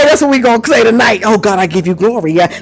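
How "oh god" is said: ordinary talk, nothing marked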